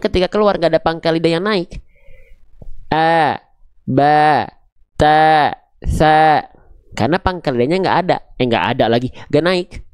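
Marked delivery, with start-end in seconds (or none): 2.9-6.4 "A, ba, ta, sa" dibaca tipis dengan vokal a; huruf tipis. Shown as a demonstration. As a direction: contoh huruf tipis, lebih keras. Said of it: "keluarnya vokal A". (guru mencontohkan huruf tipis)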